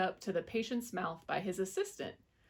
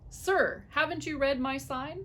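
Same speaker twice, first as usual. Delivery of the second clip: shout; 7 semitones higher than usual. A narrator's reading. Mouse dentist shouting down at the fox. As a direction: call it out sharply from above. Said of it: "Doctor DeSoto shouted".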